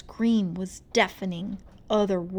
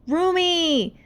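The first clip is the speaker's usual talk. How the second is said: high, loud call across the backstage; 9 semitones higher, 8 dB louder than usual. Acted. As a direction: shout her friend's name. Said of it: "Zoe cried".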